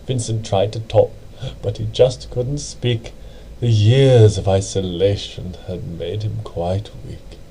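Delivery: usual speaking voice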